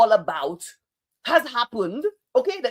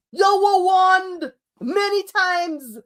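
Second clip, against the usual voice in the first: higher and very slow, voice close to breaking; slow, near tears; 8 semitones higher, much slower than usual. Real, not acted. Vlogger reminding supporters of warnings, slow and hurt. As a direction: drag it out, hurt. Follows emphatic repeat.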